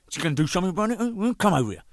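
playfully picking a fight; mock challenge.

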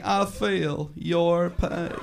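slow, drawn-out politician impression; Bill Clinton. Scarecrow consoling the traveller, slow politician voice. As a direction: stretch every word in a politician's voice. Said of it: "Bill Clinton".

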